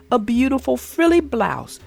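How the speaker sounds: plain reading voice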